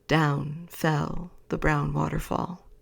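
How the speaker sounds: ordinary delivery, nothing marked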